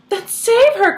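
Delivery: high, loud desperate cry. Acted. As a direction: beg him to save her. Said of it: "cried Missus Salt".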